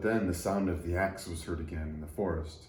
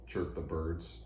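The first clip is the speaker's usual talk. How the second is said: usual speaking voice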